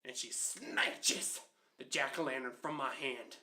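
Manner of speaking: normal talking voice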